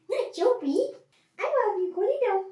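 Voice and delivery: high sing-song pet voice. Real, not acted. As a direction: coo at the puppy while scolding. Scolding puppy lovingly.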